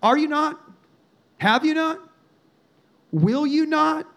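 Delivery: three bold questions, each left hanging; gutsy challenge. Retold or imitated. Preacher modeling gutsy prayer to God, boldly challenging. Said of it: "This is gutsy praying right here".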